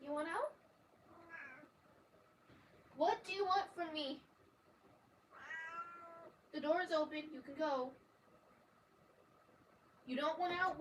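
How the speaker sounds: high, soft pleading with a cat; pet talk. Real, not acted. Questioning her meowing cat.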